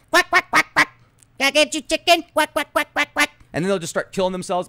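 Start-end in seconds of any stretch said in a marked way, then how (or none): 0.1-3.3 high, loud, squawky cartoon chicken quacking. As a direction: play a dim-witted modified chicken clucking away. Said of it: "They'll be walking around like".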